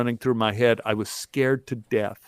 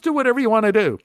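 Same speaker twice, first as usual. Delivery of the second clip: high-pitched imitation of the director; retold go-ahead; 10 semitones higher, 4 dB louder, much faster than usual. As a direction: toss out permission in a high voice. Director's retold reply, acted.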